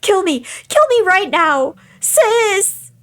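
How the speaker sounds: put-on high, frantic girl's begging; desperate pleading. Captive begs her sister to kill her instead, desperate pleading. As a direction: plead at a high pitch, building to a cry.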